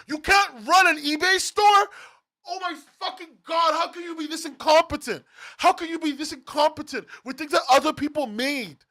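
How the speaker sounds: high, outraged ranting at full tilt; angry yelling. Real, not acted. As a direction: yell in exasperated disbelief. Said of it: "heard me yell".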